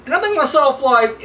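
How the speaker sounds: usual speaking voice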